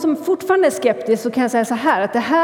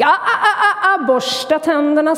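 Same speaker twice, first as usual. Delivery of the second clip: high, laughing "ah, ah, ah" before the order; mother's reminder; 6 semitones higher than usual. Acted. Mother stops hedgehog leaving, high teasing reminder. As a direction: stop him with a playful warning. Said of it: "sa mamma".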